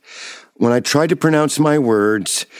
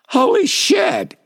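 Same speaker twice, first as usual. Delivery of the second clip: high, slow, stunned outburst; shocked exclamation; slower than usual. Retold or imitated. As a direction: gasp it out in disbelief. Shock at doctor's answer.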